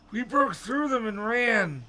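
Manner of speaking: high, slow character voice; high-pitched old man; Mister Dawes. A narrator's reading. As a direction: put on a high, drawn-out voice. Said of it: "Mister Dawes said".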